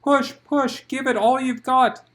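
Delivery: loud, high urging; urging push. Acted. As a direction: coach her to push. Coaching a birth.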